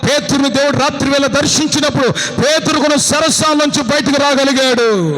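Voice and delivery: loud, high preaching at full force; fiery preaching. Acted. Preacher thundering to the congregation, loud and high. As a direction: cry it out like a revival preacher.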